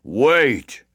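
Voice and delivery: loud giant's growled order; growling giant. A narrator's reading. Giant stops the boaster running off, loud growl. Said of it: "growled the Giant of the Forest".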